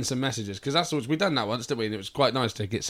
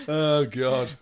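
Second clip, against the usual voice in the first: ordinary delivery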